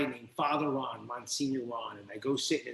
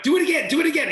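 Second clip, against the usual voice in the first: much higher and louder, eager repeat; excited plea; 13 semitones higher, 10 dB louder, much faster than usual. Retold or imitated. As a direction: beg like a thrilled kid, loud and high. Begging priest to repeat trick.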